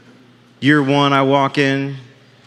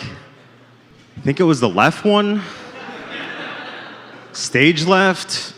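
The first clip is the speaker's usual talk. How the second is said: deadpan reply; 4 dB quieter, much slower than usual. Retold or imitated. Punchline reply to doctor.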